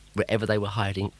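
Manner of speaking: usual speaking voice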